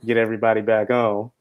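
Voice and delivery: ordinary talk, nothing marked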